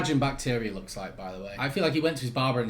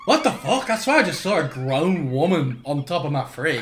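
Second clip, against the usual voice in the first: bewildered onlooker; 3 semitones higher, 4 dB louder than usual. Acted. Onlooker seeing a grown woman on the fridge, aghast. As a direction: play a flabbergasted bystander. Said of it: "just looking around like".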